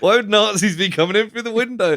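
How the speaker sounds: mock the dad scolding his son; flustered dad. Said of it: "He's like".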